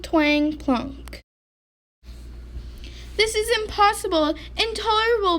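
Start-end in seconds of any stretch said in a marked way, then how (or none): 3.2-5.4 high, fast attempt at a roar; weak roar. A narrator's reading. Sick bear trying to roar at the mouse, weak roar. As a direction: start to roar but too weak. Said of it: "Bear started to roar".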